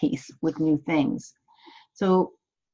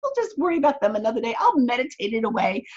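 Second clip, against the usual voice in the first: much higher, airy voice brushing every problem off; mock carefree dismissal, pitched way up; breezy denial; 12 semitones higher than usual. Coach mocking a client in denial about unpaid bills, high airy brush-off. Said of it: "It's like".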